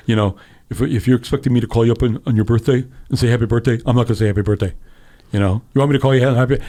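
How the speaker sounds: lightly voiced grumpy old senator; slight impression of a blunt old politician; a hint of a put-on curmudgeon voice; Bernie. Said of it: "You do a better Bernie voice".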